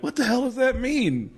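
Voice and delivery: high, incredulous question; baffled after he walks away; bewildered outburst. Retold or imitated. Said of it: "and I went".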